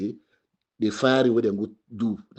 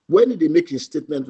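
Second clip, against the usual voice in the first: normal talking voice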